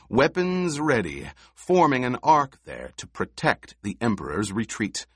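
ordinary delivery, nothing marked

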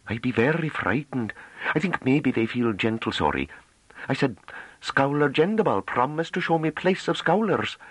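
high, timid rustic woman's voice; a scared country girl retelling the guards; young Hamish woman, high and anxious; frightened farm girl. A narrator's reading. Novi recounts the gate.

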